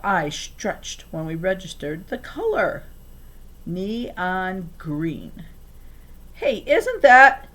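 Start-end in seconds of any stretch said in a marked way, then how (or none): 6.3-7.5 loud, slow, high gasp of recognition; girl spotting the stolen car; surprised. A narrator's reading. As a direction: stop short in disbelief. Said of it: "Lupe asked".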